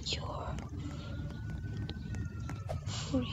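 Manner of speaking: quiet unvoiced whisper. Real, not acted. Player releasing someone, hushed whisper. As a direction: let it fade to a breath.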